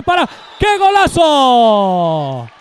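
high, loud goal call; breakneck goal commentary. Real, not acted. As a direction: scream the goal, stretched and soaring. Goal scored, commentator erupts.

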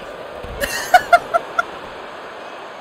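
breathy high-pitched wheeze of a laugh; high squeaky laugh. Real, not acted. Gamer cracking up at his opponent, squeaky laugh. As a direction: let a squeaky silent laugh escape.

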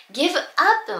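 "give up" slowly, as two separate words. Shown as a demonstration. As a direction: say "give up" unlinked, "gibu appu". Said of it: "日本語だと言うと思う". (「give up」をギブ・アップと区切って発音)

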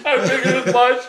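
high and loud through a laugh; laughing out the words. Real, not acted. Laughter about the blind dog.

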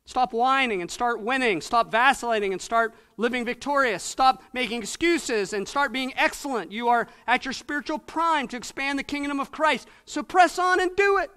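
loud, rising exhortation hammered home; preaching at full force. Acted. Preacher rousing young believers, fiery exhortation. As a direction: drive each command like a rallying cry.

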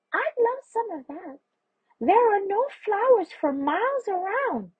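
very high, tiny bee voice. A narrator's reading. Hungry bee asking a man for honey, in a tiny high voice. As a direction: squeak it out small, like a little bee. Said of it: "A tiny voice said".

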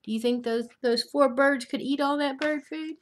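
usual speaking voice

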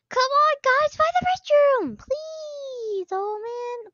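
high begging to the team; pleading. Real, not acted. Player pleading with teammates to find the restroom. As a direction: urge them on, almost whining.

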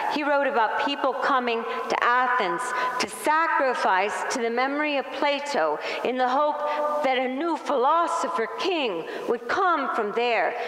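normal talking voice

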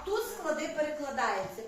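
usual speaking voice

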